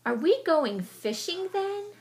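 high, slow mouse question; eager question. Acted. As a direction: ask eagerly with rising hope.

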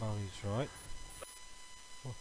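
hushed whisper. Real, not acted. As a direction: whisper it in alarm. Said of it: "Oh no".

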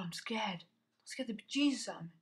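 ordinary talk, nothing marked

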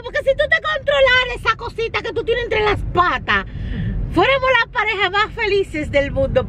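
high-pitched mock lovers' reproach; mock scolding the dog. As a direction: scold the pet like a jilted partner, voice way up. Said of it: "Yo le dije". (regaño en broma al perro, con voz muy aguda)

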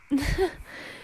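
short, light scoffing laugh; dismissive chuckle. Acted. Werewolf brushes off being found out, dismissive chuckle. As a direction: laugh off the accusation briefly.

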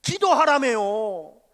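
high, loud indignant protest; a preacher protesting his unanswered prayer to God, loud; complaining to God. Retold or imitated. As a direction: demand an answer from God, aggrieved. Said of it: "또 하나님 앞에 불평하는 거예요".

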